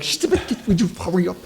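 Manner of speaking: high flustered whisper; hurried, flustered aside. Retold or imitated. Answering wife while fighting device.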